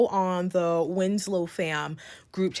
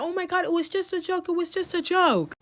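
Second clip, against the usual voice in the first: much higher, whiny brush-off; mock dismissive excuse; 10 semitones higher than usual. The friends brushing off the push, high mocking whine. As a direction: mimic them shrugging it off.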